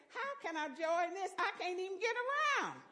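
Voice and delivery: how can I possibly, she protests; exasperated protest. Retold or imitated. Exasperated inner reply to God.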